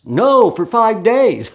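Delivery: high, loud, stretched-out protest; audience response. Retold or imitated. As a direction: imitate an audience member correcting emphatically. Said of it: "I'll ask the audience".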